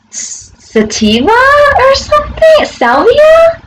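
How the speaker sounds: very high, drawn-out questioning squeak; unsure guess. Real, not acted. Trying to recall a drug's name, high unsure guess.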